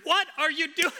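loud, high, exasperated demand. Retold or imitated. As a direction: call it out so they can hear. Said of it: "And I'm being very loud".

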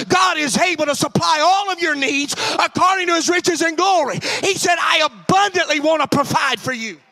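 loud, rising preacher's cry; preaching at full force. Acted. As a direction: drive it home to the congregation.

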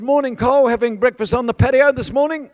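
put-on chirpy teenage boy. Teenage boy greets stunned storm survivor on the street, breezy call. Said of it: "This teenage boy comes walking down".

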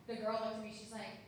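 usual speaking voice

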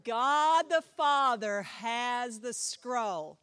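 each word laid down slowly and heavily; slow emphatic reveal. Shown as a demonstration. Teacher to class, revealing the answer slowly and loudly. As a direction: land the answer like a verdict, loud and slow. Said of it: "emphatic teaching reveal".

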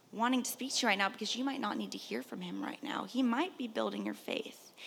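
hushed, intimate whisper. Real, not acted. Preacher softly tells the church God may be silent, whispering. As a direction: draw them in with a whisper.

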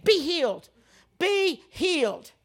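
high, loud, second one drawn out slowly; insistent healing command. Retold or imitated. Preacher stomping over a sick friend, insistent command. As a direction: hammer it home like a stomping foot.

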